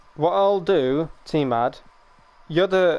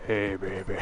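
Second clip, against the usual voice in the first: deep husky voice; 5 semitones lower, 6 dB quieter than usual. Acted. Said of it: "the next minute it's like".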